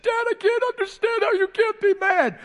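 very high, strained son's plea; son's high broken voice. Retold or imitated. Son at the door pleads with his father, high and strained. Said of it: "He said".

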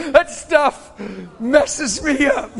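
loud, high laughing speech; overcome with laughter. Real, not acted. Preacher undone by the message, real laughter. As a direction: laugh helplessly through the words.

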